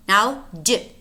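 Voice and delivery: ordinary talk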